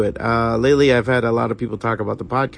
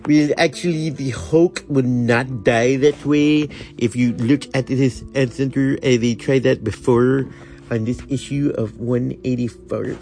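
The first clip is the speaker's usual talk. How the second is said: nerdy know-it-all; 2 semitones higher than usual. Retold or imitated. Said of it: "I instantly got that nerd thing".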